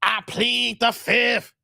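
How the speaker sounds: slow, spaced-out catchphrase; sketch catchphrase. Retold or imitated. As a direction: stretch each word for comic effect. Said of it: "then it's like".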